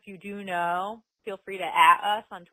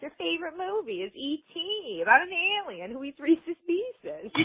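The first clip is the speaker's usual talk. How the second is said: higher, lightly voiced old lady; quoting Joey as a mild old lady; 9 semitones higher than usual. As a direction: put on a hint of a fussy old woman. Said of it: "I don't know why she became a whole old lady".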